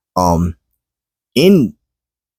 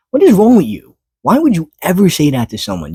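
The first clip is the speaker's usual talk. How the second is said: outraged disbelief; 9 semitones higher than usual. Real, not acted. Outraged rhetorical question.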